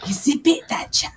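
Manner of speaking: sore throat voice. Acted. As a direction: hiss it through a sore throat. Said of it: "That's just my sore throat voice".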